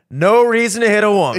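loud, high mock outrage; incredulous retort. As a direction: throw it back in comic disbelief. Comic's outraged retort, reenacted.

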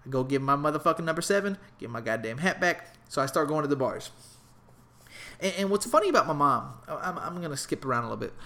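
ordinary talk

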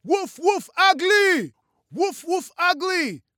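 put-on high, very loud boyish screech; shrieked playground chant. A narrator's reading. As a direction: screech it like two gleeful young brothers. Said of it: "Godot and Steve screeched".

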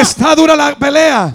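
high, loud rallying cry; fervent preaching. Acted. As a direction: preach it at full force to the crowd. Preaches at full force.

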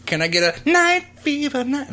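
high silly voice ordering; high mock customer voice. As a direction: squeak out a goofy order. Mock disco diner order.

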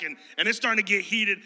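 ordinary delivery

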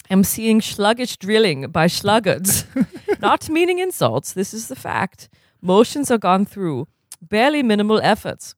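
lightly put-on German accent, formal and measured; a stern German coach scolding in a mild German accent. Stitt lecturing sluggish players at dawn drills, mild German accent. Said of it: "do the accent again".

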